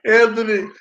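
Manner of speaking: usual speaking voice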